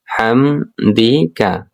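ওয়া বি হামদিকা drawn out very slowly; slow recitation demo. Shown as a demonstration. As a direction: spell it out slowly for learners. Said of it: "গলার মধ্য থেকে উচ্চারণ". (ধীরে ধীরে উচ্চারণ)

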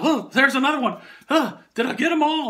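high, loud flustered yelps; mock panic from the covered manager; panicky manager. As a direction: play the boss frantically brushing off ants. Manager picking ants off, panicking.

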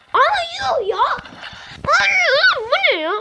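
high shocked yelp; shocked outcry. Real, not acted. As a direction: squeal in disbelief at the screen. Shocked at getting killed. (ekrana şaşkınlıkla tiz bir çığlık)

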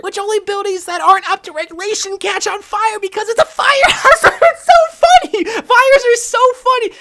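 words bursting into high laughter; cracking up at her own fire joke; laughing. Real, not acted. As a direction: laugh through the punchline.

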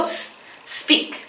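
a soft "psst" hiss flowing straight into "speak"; hissed s first. Shown as a demonstration. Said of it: "Primero el sonidito". (un "psst" suave que se funde directo en "speak")

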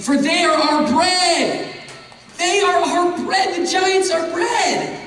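they are our bread, shouted; shouted climax. Acted. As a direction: cry it out in triumph. Preacher climaxes on the verse.

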